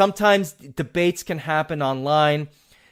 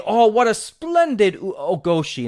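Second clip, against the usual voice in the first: lightly imitated British commentator, higher pitched; a hint of delighted British commentary, pitched up on "splendid"; 5 semitones higher than usual. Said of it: "in that wonderful British accent".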